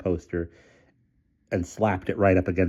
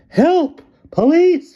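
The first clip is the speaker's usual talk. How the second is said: very high, louder wail; wailing for help; 9 dB louder, much slower than usual. A narrator's reading. Miss Scrimmage wailing for help from her balcony. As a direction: wail for rescue. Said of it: "she wailed".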